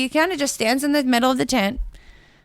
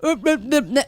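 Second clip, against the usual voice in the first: flustered stammer; 4 semitones higher than usual. Retold or imitated. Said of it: "he just".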